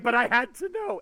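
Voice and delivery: high, loud pleading insistence; desperate fan. Retold or imitated. Fan explaining why she read at stoplights, pleading. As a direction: plead it like a hooked reader.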